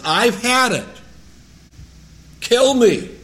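loud, high despairing outburst; despairing cry. Acted. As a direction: cry out like you've given up. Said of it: "he says".

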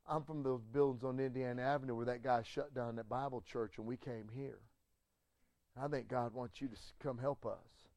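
a hint of tears, softly asking for help; soft tearful plea. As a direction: hush it like a man near tears. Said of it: "with a tear in his eye".

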